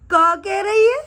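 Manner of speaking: high, loud incredulous question; amused disbelief. Retold or imitated. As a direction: act amused and taken aback. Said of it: "हंस हंस के कहता है". (हँसी भरे अचरज में, ऊँची आवाज़ में)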